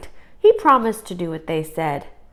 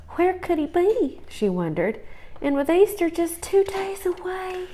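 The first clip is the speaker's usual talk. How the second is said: high, lightly voiced fretful mother; a mother wringing her hands; worried mother; 11 semitones higher than usual. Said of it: "His mother wept".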